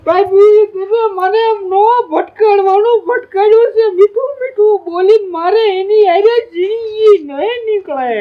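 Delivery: high, sing-song sobbing wail; mock farewell wailing. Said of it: "એકાદાને રોવું પડે".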